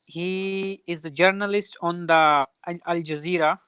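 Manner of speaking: ordinary talk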